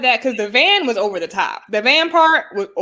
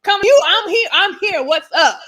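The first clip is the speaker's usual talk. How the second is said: mock confrontation; 9 semitones higher than usual. Acting out the confrontation.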